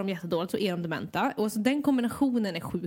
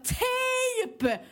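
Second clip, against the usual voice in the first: very high, drawn-out mock scream; retold scream. Care worker re-enacts screaming at old lady, exasperated high shriek. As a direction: scream it as if at someone who won't understand. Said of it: "jag stod där och skrek åt henne".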